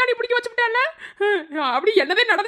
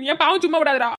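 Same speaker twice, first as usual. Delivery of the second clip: low, crying reproach; tearful curse; 5 semitones lower than usual. Acted. Grandmother weeps and curses her grandson. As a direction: sob out the curse on him.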